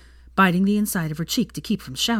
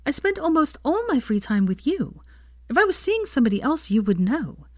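higher, firm reassurance; a hint of Hermione making her point; lightly voiced Hermione; 9 semitones higher than usual. Hermione reassures Draco, higher and firm.

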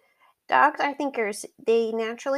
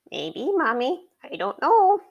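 lightly voiced little dog; pitched-up playful pet voice; Truffles' voice; 5 semitones higher, 4 dB louder than usual.

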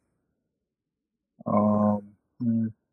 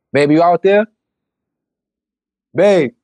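calling out; 8 semitones higher, 6 dB louder than usual. Real, not acted. Calling partner in another room.

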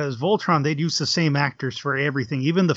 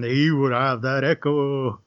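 lightly voiced giant, slow and drawn out; slower than usual. Shown as a demonstration. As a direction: stretch it out like an echoing giant. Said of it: "what they do with a giant voice".

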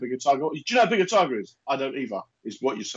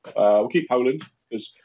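ordinary talk

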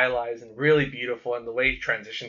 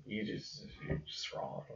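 unvoiced whisper, jokingly; whispered teasing; 2 semitones lower, 18 dB quieter, slower than usual. Real, not acted. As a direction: whisper the insult playfully. Teasing friend under breath.

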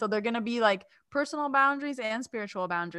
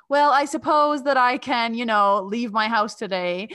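slow, loud, put-upon sigh of a reply; grudging reluctance; 4 semitones higher, 8 dB louder than usual. Retold or imitated. Answering friends who insist she come, slow grudging drawl. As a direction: drag it out like a huge favour.

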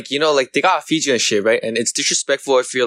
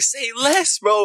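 much higher, mock-eager "say less, bro"; eager agreement; 12 semitones higher, much slower than usual. Retelling his camping-trip answer, much higher mock-eager. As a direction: jump up high in an overexcited yes. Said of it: "I was like".

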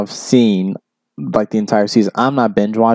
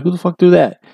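imitating furious coach; 7 semitones higher than usual. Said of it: "he's like".

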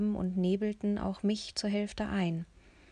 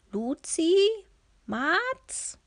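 higher, louder calling across a hallway; 10 semitones higher, 7 dB louder, much slower than usual. A narrator's reading. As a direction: call their names into the dark. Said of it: "Bennos Stimme hallte durch den Flur". (laut rufend, höher)

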